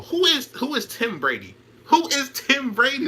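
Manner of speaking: high, slow disbelieving question; incredulous mock. Streamer mocking a commentator's name slip, high and incredulous. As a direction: ask it like the name is absurd.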